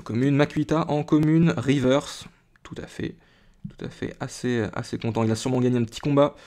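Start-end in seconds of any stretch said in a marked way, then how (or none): 2.6-3.2 breathy whisper to himself; whispered aside. Real, not acted. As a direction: say it almost under your breath. Muttering agreement to himself.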